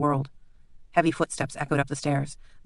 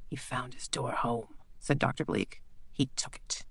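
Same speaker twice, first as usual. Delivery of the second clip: much softer, low and measured; quiet menacing doctor; 3 semitones lower, 10 dB quieter than usual. Acted. Bleak quietly reminds the lord of their deal. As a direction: speak low like distant thunder.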